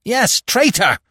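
high, loud, venomous retort; fierce high-pitched hiss. A narrator's reading. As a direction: spit out the accusation. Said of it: "hissed the fox".

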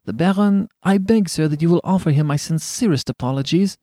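flustered, high-pitched apology; nervous, pleading stammer, lightly done. A narrator's reading. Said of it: "stammered Hughie".

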